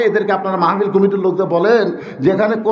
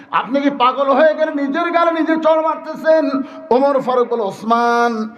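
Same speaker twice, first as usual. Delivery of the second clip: raised, chanting storytelling voice; high-pitched preaching; 9 semitones higher than usual. Real, not acted. Preacher retells Osman questioning Omar, high preaching. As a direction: carry the retold question high to the crowd.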